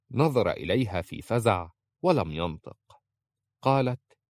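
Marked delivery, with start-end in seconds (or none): none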